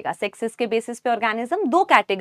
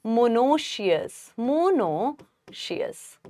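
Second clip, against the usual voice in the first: "Monoecious" stretched slowly, softer the second time; slow dictation; 4 dB quieter, much slower than usual. Shown as a demonstration. Teacher giving the new term to students, drawn out. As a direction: let the class write it down. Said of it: "इसको हम क्या word use करेंगे दूसरा".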